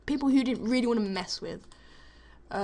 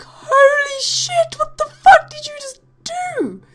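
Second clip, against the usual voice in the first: high, loud and slow disbelieving scream; shocked outcry; 12 dB louder, slower than usual. Retold or imitated. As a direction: yell it like you can't believe your eyes. Reacting to hair being cut.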